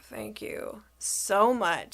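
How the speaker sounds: slow, drawn-out thanks; acted relief, said slowly; retold gratitude. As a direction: stretch out each word of thanks. Retold thanks for big discount.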